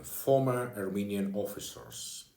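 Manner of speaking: usual speaking voice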